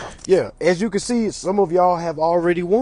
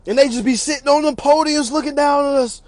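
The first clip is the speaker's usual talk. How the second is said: normal talking voice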